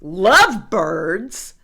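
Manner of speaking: loud, slow, incredulous question; appalled elephant echo, rising at the end; disgusted. A narrator's reading. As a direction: stretch the word out in loud disgust. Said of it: "He's disgusted".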